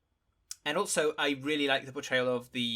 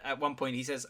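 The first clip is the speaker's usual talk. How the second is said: ordinary talk, nothing marked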